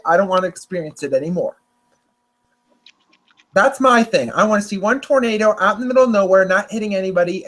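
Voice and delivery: normal talking voice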